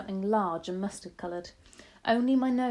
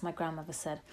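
ordinary delivery

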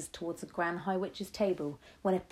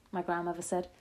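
plain reading voice

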